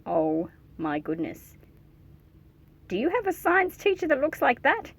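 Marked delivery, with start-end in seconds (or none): none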